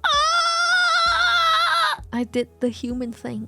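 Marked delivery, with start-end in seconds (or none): 0.0-2.0 sharp loud high shriek of disgust; shrieking in disgust at the toilet floaty. Real, not acted. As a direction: let out a high yelp of disgust.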